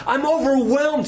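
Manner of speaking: high, pressed cry of overwhelm; overwhelmed plea. Retold or imitated. Jesus confiding in his disciples, overwhelmed. Jesus's anguish voiced in sermon.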